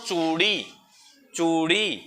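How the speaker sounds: चूड़ी with a stretched oo, falling; slow teacher's demonstration of चूड़ी; long ऊ. Shown as a demonstration. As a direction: linger on चू in चूड़ी. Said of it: "चूड़ी में कैसे बोल रहा हूँ".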